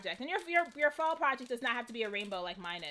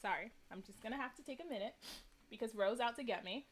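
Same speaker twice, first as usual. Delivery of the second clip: holding back tears; 5 semitones lower, 8 dB quieter than usual. Real, not acted. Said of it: "I'm gonna try not to cry".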